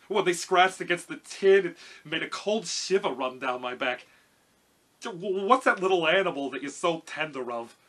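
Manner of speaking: loud, lightly voiced nervous lion; cowardly lion. A narrator's reading. Lion tells Dorothy of his scare, loud character voice. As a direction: project it as a timid lion.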